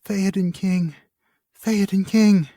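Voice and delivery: high, keening lament for the king; mournful crying out. Acted. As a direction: cry his name out in grief.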